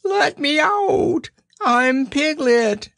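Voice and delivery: very high, slow Piglet squeal; crying out. A narrator's reading. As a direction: cry for release in a high, drawn-out voice. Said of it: "cried Piglet".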